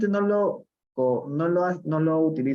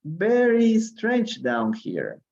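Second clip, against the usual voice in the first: slow, high storybook read; reading aloud; 10 semitones higher, slower than usual. Acted. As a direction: read it out slowly to the class. Reading Alice's thoughts aloud.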